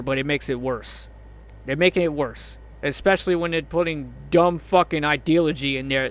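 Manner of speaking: normal talking voice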